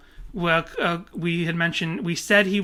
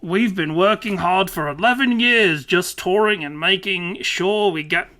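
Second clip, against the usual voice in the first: hammy, loud Aussie read; mock Australian accent with stretched vowels; bad Australian accent; 2 semitones higher, 4 dB louder than usual. Podcaster reads band singer's answer, hammed-up Australian accent. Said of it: "And [name] says".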